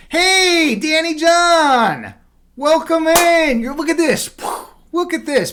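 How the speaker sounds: real hype greeting for a returning subscriber; loud excited greeting. Real, not acted. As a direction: shout a big hello to a new viewer. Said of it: "am I talking really loud today?".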